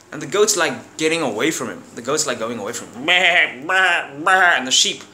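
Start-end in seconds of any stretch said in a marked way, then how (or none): 3.1-4.6 bleating baa like a goat. Retold or imitated. Goat running from Ababa, mock bleating.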